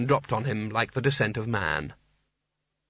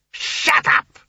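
high, loud explosion of temper; furious shout; 6 dB louder than usual. A narrator's reading. Sconner cutting off the meek wizard, furious shout. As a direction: bellow it to silence him.

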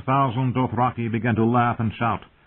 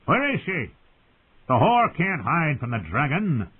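put-on high-pitched drunken tirade; Viserys, drunken shouting; 9 semitones higher than usual. A narrator's reading. Drunken would-be king gatecrashes his sister's feast, ranting thickly. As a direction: slur it high and imperious, thick with wine. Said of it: "his voice thick with wine".

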